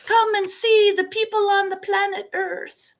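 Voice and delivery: high, bright mock tour-guide pitch; alien tourism ad. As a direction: sell Earth like a tourist attraction. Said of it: "it's tourism from the other side".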